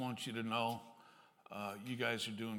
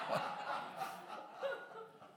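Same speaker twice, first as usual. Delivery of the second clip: breathy laugh; 6 dB quieter than usual. Real, not acted. Laughs at own joke.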